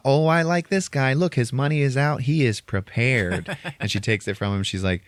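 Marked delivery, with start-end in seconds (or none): 0.1-3.6 high, approving voice, slowing at end; lightly voiced sugary manager; sweet lady. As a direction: coo it over the money. Pimp lady sizing up customer.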